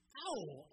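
sudden loud cry of pain; short acted ouch of pain; pained yelp. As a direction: yelp as if your foot got crushed. Balaam's foot crushed against rock.

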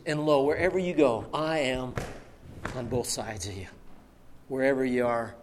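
low, hushed promise; tender reassurance. Retold or imitated. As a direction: speak softly to comfort, unhurried. Voices Jesus's promise of presence.

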